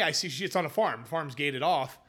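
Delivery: usual speaking voice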